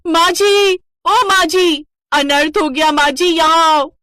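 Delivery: high, loud calling out for her mother-in-law; daughter-in-law shouting for help from the kitchen, loud. Acted. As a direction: yell across the house in alarm. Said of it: "माला की जोरदार आवाज से पूरा घर गूंज जाता है".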